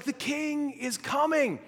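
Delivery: high, drawn-out herald's proclamation; slow, ringing town-crier call; herald's announcement. Acted. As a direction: announce it like a royal herald, pitched well up. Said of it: "there would be this herald that, like, announced".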